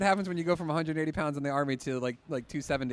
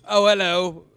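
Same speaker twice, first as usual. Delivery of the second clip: loud, over-the-top Cockney greeting; strong Cockney accent; 4 semitones higher, 13 dB louder than usual. Retold or imitated. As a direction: belt it out in broad mock Cockney. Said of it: "going into the British accent, like the Cockney, like the strong".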